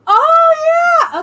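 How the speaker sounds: sudden high, loud yell of recognition; excited shout. Real, not acted. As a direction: burst out as it clicks. Suddenly remembering.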